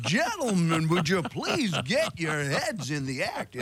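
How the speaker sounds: imitating his boss. Mimicking the director addressing the gentlemen, slow and high. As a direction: mimic a fussy bandleader calling the group. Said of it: "That's how he talked".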